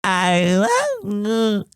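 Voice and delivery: put-on slow, garbled baby voice; lightly voiced baby babble; imitating baby's sounds. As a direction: mimic a baby babbling the words. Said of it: "and he went".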